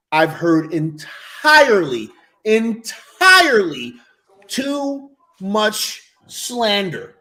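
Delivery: very slow, drawn-out ENTIRELY; grandly drawn out, word by word; slow emphatic declaration. Real, not acted. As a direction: stretch each word for dramatic weight. Emphatic declaration, repeated more casually after.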